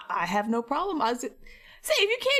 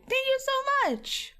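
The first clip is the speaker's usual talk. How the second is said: high, slow, drawn-out thank you; gushing thanks; slower than usual. Real, not acted. Thanking a donor for the thousand biddies, high and drawn out.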